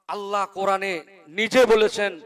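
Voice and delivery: ordinary talk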